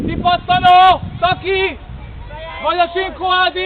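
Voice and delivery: loud sideline yelling; shouted call. Real, not acted. Coach calling players to the rebound, shouting. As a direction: call out hard to players far away.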